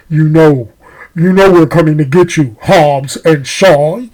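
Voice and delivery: low-pitched, menacing mastermind with evil laugh; hammed-up secret-organization boss, dropped low; big bad villain. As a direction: threaten them like a hidden cartoon supervillain. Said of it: "the ultimate big bad villain reminded me of, like, Cobra Commander".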